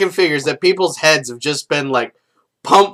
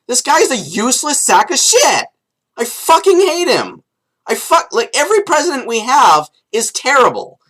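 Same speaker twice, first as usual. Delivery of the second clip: raised pitch, louder, yelling insults at the president; angry rant; 9 semitones higher, 3 dB louder than usual. Real, not acted. Vlogger yelling insults at the president, furious rant. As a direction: tear into him at the top of your voice.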